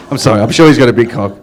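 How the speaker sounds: normal talking voice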